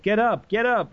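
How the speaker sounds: lightly voiced boyfriend nudging; mock urging. Boyfriend telling his dead concubine to rise, mildly impatient. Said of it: "he's like".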